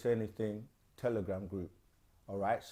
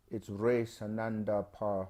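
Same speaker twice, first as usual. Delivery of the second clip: ordinary delivery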